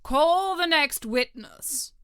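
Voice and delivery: slow, loud royal summons; a king calling the court to order; king's voice. A narrator's reading. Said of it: "said the king".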